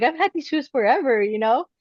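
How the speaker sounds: breezy high-pitched deflection; retold clueless act; feigned ignorance. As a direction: play innocent about what mom noticed. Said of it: "like if I didn't know".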